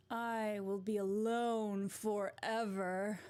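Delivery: slow, drawn-out villainess; hammed-up villain lament ending in a laugh; slow theatrical evil-queen voice; Maleficent. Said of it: "Maleficent would be very much like".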